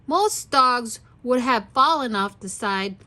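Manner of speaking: ordinary delivery, nothing marked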